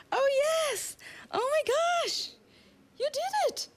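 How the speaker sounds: very high, delighted squeals; excited. Real, not acted. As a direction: burst with joy at her success. Student holds headstand unsupported.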